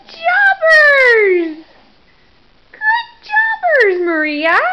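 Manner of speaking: high squealing cheer; squeaky praising a dog. Real, not acted. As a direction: squeal it out, thrilled. Cheering baby feeding herself.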